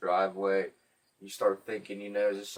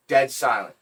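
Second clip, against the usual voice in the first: normal talking voice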